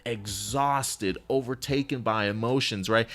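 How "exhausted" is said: normal talking voice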